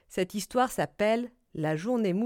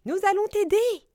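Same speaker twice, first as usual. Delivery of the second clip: very high, louder little squeak; squeaking mouse; 11 semitones higher, 6 dB louder than usual. A narrator's reading. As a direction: squeak out an offer of help. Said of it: "couina la souris". (petite voix couinée de souris)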